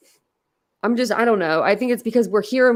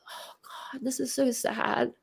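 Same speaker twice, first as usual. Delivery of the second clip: hushed, breaking into tears; soft, holding back real crying; real tearful grief; 13 dB quieter than usual. Real, not acted. Streamer upset reading court news live, tearful and hushed. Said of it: "I just cried on a live".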